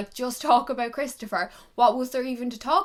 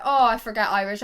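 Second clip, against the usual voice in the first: ordinary delivery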